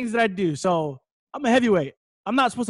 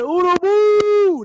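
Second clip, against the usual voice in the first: high, loud yell with a long drawn-out final vowel; imitated crowd yell; 13 semitones higher, 8 dB louder, much slower than usual. Kids greeting him at the gym, yelled nickname. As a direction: holler it like a whole gym of kids. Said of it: "they yelling and they screaming".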